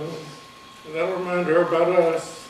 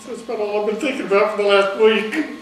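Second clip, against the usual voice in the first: laughing and crying; 3 semitones higher, 3 dB louder than usual. Real, not acted. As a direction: laugh through the tears, voice cracking. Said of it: "I'm sorry I'm laughing and crying".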